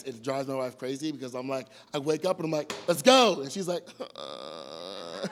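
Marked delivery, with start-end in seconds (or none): 2.9-3.4 loud, pumped-up call to get going; imitating his own loud hyped morning wake-up call. 4.2-5.3 groggy groan. Retold or imitated.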